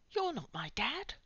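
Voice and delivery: put-on mildly accusing girl's line. A narrator's reading. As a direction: challenge the stranger. Girl confronts stranger.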